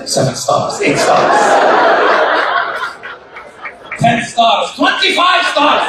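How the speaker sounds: building from a breathy start to a high, drawn-out shout; mock-grand escalation; escalating boast. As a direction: top each claim bigger, ending in a shout. Boasting of ever more hotel stars.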